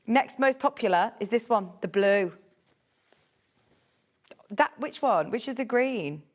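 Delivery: ordinary talk, nothing marked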